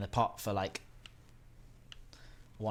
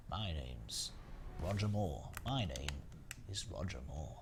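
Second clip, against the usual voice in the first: low, soft suave Bond drawl; Roger Moore; 7 semitones lower, 8 dB quieter, slower than usual. Retold or imitated. Streamer practising Roger Moore, low and soft. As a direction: purr it like an old Bond. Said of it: "I'm working on my Roger Moore impression".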